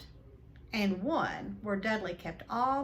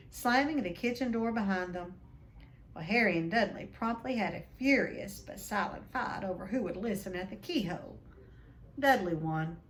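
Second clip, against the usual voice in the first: ordinary delivery, nothing marked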